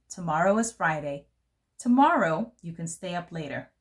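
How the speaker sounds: ordinary delivery, nothing marked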